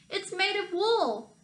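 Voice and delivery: put-on high, slow child's discovery; second child noticing the wool, high and slow. A narrator's reading. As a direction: spell out the clue like a wondering child. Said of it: "said the other".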